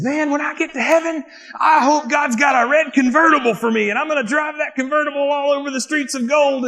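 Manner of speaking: high-pitched, fixated revival preacher impression; excited evangelist. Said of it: "the evangelist was preaching".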